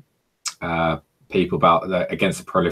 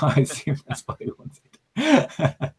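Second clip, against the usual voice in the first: put-on American accent; 8 semitones higher, slower than usual. Still in the put-on voice.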